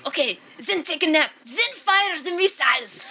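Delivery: high, imitated cartoon French accent; mock French accent. Quoting the end of the world video, French accent. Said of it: "that fucking video where it's like".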